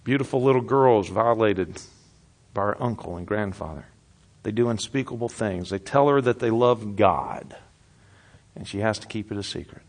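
soft, grave, slowed sermon voice; hushed preaching. Acted. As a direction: draw them in quietly with grief.

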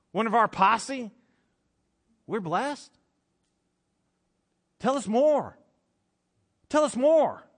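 rising amazement, climbing up to an eager high plea; amazed crowd. Retold or imitated. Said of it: "And they're like".

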